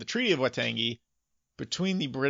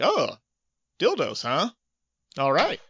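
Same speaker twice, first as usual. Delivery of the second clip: mock surprised coworker; 4 semitones higher, 5 dB louder than usual. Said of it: "walking in and being like".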